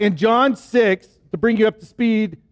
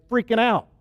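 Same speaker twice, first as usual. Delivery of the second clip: ordinary talk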